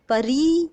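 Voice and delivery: "परी" said slowly with the final री held long; stressed long ई. Shown as a demonstration. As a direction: lean on the long ee ending of "परी". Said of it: "जोर दे के बोली जा रही है". ("परी" में री पर ज़ोर देकर खींचें)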